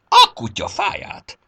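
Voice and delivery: high, loud exclamation of outrage; startled, furious cry; angry stamping outburst. A narrator's reading. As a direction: burst out, stretching the curse. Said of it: "toppantott télapó".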